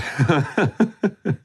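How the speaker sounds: high-pitched staccato laugh; wild laugh at the peak of rage; hysterical furious laugh. A narrator's reading. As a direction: burst into a high, angry cackle. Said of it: "který vyvrcholil výbuchem zuřivosti".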